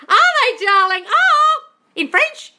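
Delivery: high, loud, gushing squeal; thrilled, with voice soaring up; delighted surprise. Real, not acted. Coach reacting with delight to a viewer's comment, high and loud.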